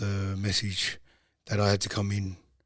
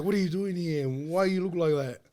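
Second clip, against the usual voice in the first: higher, surprised auntie at the door; auntie's surprise; 8 semitones higher than usual. Retold or imitated. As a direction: play the startled aunt asking why he's here. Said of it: "so she's like".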